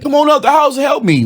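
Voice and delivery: high, pleading mock voice; mock plea for help. Mocking Trump voters who now want help. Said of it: "now you can't be like".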